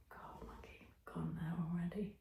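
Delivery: whispered aside to the co-host; scandalised whisper. Real, not acted. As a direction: hush it so only one hears. Aside during crude story.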